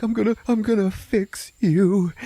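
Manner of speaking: high-pitched frantic character voice; mock desperation. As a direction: promise rescue in an overwrought high voice. Jar husband desperately addressing broken wife.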